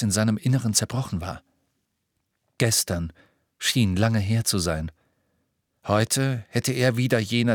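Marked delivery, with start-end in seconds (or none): none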